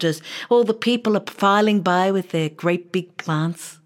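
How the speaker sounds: plain reading voice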